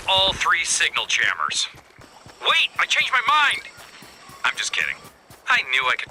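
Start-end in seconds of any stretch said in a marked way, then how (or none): 2.4-3.6 high, loud pretend change of heart; mock alarm. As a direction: cry out in mock panic, jumping much higher. Joke alarm, then admits kidding.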